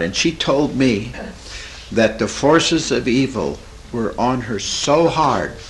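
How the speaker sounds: normal talking voice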